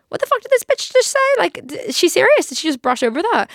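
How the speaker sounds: high, loud imitation of disbelief; shocked viewer. YouTube viewer hearing her cancer aside, mock shock. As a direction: start high and outraged, easing by the end.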